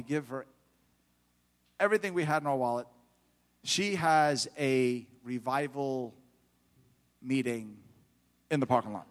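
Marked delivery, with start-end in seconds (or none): none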